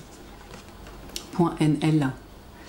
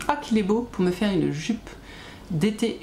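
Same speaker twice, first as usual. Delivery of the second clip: ordinary delivery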